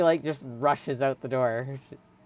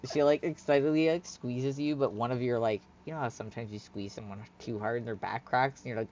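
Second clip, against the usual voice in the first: ordinary talk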